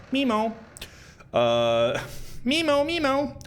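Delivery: singsong memo memo; high teasing repetition; playful high repeat. Acted. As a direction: toss the word up high playfully.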